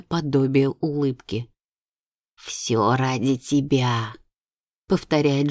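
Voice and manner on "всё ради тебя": eerie ghost voice. Acted. Ghost queen speaks.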